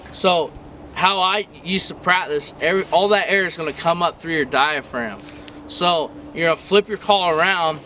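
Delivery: ordinary talk, nothing marked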